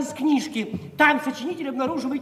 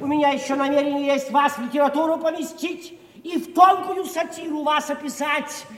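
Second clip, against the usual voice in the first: ordinary talk, nothing marked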